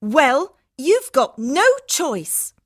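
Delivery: high, loud, slow ultimatum; angry. Acted. Harminder forces two women to share car, angry. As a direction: drive it home, drawing out the end. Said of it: "Harminder was speaking angrily".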